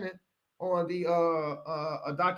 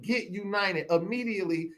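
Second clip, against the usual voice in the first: normal talking voice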